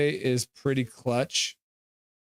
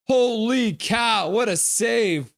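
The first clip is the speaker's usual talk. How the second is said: slow, loud and higher, drawn-out awe; amazed exclaim; 9 semitones higher, 5 dB louder, slower than usual. Real, not acted. Fan stunned by the goalie's save, slow loud awe. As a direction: savor each word in disbelief.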